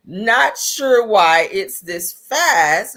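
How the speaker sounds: dragged, loud, slowed-down audio; stretched out like a slowed clip; slowed playback. Real, not acted. Cohost puzzled as the recording plays oddly slow. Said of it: "I didn't speed it up".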